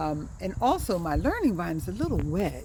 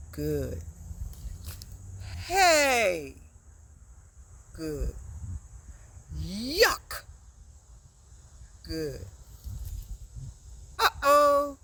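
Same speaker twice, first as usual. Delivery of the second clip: expressive interjections; much slower than usual. Shown as a demonstration. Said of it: "Say them after me".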